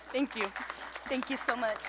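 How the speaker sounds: tearful thanks. Real, not acted. Honoree thanking the room for her award, tearful. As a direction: barely get the thanks out through tears. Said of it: "I'm really trying not to cry".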